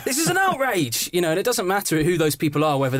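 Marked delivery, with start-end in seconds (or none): 0.1-1.1 high-pitched gasp of indignation, hammed-up; mock-horrified squawk; mock outrage. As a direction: imitate scandalised viewers in a much higher voice. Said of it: "they're going".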